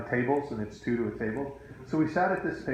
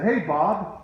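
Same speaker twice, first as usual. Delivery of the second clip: blurted greeting; 6 semitones higher, 5 dB louder than usual. Retold or imitated. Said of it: "and I said".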